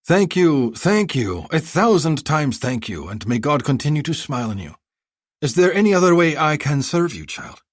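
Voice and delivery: high, effusive haggling vendor; a flattering bazaar seller pitching; eager merchant. Acted.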